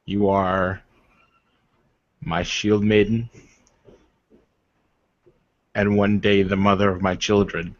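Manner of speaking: a hint of a formal, courtly warrior; lightly voiced noble warrior. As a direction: speak as a proud suitor, measured and earnest. GM voices NPC suitor.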